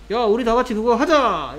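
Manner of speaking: rally everyone to join in; rallying suggestion. Acted. Teacher voicing a let's-all-do-it example to the class, rallying.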